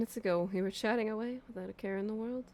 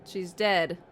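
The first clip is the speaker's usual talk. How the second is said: usual speaking voice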